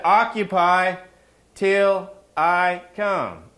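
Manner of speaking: slow, weighty Occupy till I come; stressed scripture quote. Acted. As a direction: lean hard on Occupy. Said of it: "do you know what he said".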